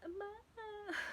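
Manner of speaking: soft, high whimper of a call; whispered mama. Retold or imitated. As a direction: whisper for mom like a scared kid. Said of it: "I was whispering".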